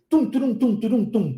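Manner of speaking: tum, turum, tum sung like a drum beat. Shown as a demonstration. Teacher imitating a drum kit, tum turum. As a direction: beat out the rhythm like a drummer. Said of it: "aquele prato da bateria".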